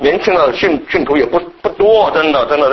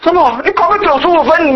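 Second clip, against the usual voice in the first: usual speaking voice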